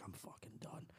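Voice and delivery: acted whisper of doom; hushed inner aside; whispered dread. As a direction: whisper it to yourself as you get caught. Inner thought at bag search.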